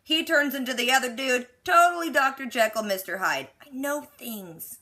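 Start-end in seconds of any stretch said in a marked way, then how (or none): 3.6-4.8 softer, slow self-satisfied murmur; quietly smug boast; smug aside. Real, not acted. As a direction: say it slowly, pleased with yourself. Smug aside after recap.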